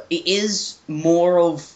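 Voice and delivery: normal talking voice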